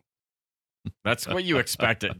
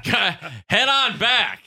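drawn-out, higher invitation to follow; lightly voiced waitress; 10 semitones higher, 3 dB louder, much slower than usual. Waitress sending diners back to the kitchen, slow mock invitation. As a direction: call it out slowly, pitched up.

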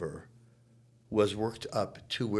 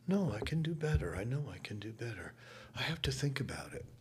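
quiet; 3 semitones higher, 7 dB quieter, faster than usual. Retold or imitated. Star insisting she can improve.

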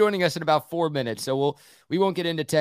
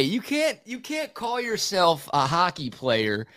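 high, slow and incredulous, stressing can't; can't believe what he just heard; 5 semitones higher, slower than usual. Real, not acted. Incredulous objection to figure skates.

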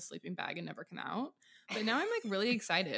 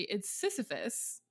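ordinary delivery

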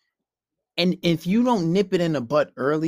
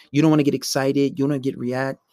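ordinary talk

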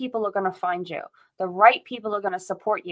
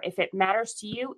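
normal talking voice

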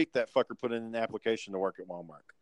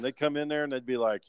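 ordinary talk

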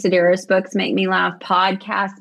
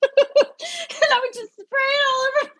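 high, helpless laughter through the words; laughing while talking. Real, not acted. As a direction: crack up mid-story. Cracks up telling her own story.

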